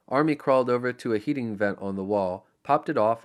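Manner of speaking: ordinary delivery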